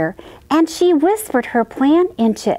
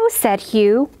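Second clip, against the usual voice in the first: usual speaking voice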